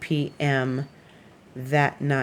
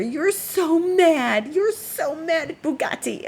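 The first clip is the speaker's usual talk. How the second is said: cooing to a pet; 11 semitones higher, slower than usual. Real, not acted. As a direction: coo at her much higher and slower, teasing. Teasing the offended cat.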